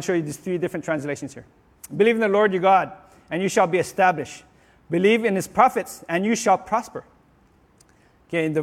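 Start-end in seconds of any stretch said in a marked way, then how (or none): 2.0-7.0 raised, declaiming scripture voice; scripture reading. Acted. Preacher reads the verse to the congregation, proclaiming.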